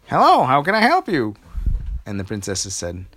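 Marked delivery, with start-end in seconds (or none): 0.1-1.4 put-on very high, loud old lady voice; forgetful granny asking again. Old lady finally agreeing to help, high and loud. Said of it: "she said".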